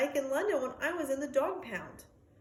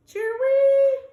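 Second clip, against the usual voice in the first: high, loud cheer-wee call; sad bird call; 14 dB louder than usual. Acted. The caged bird calling to the dog, high and loud. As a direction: chirp it out like a lonely bird. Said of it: "sad song".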